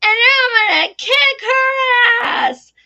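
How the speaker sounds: put-on high, loud, drawn-out kid shout; mock child's battle cry, stretched out slowly; imitating Edward. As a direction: yell it like a defiant little boy. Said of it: "And he said".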